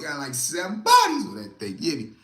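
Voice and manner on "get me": usual speaking voice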